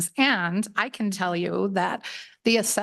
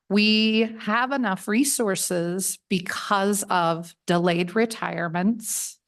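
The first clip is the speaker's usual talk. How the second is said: ordinary delivery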